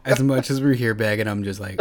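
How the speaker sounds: usual speaking voice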